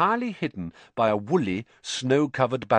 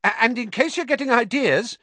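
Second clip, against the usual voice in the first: high, louder, stammered start; hasty, nervous; 5 dB louder than usual. A narrator's reading. Ernie protecting himself from Harry, hastily nervous. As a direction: blurt it out in self-defence. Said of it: "he added hastily".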